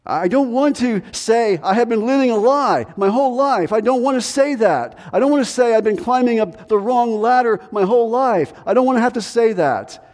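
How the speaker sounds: high-pitched, imitated protest at having to admit it; rifiuto recitato. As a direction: play an objector resisting confession, pitch held high. Acted believer refusing to admit error.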